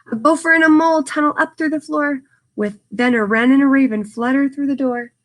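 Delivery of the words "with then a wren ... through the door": ordinary delivery, nothing marked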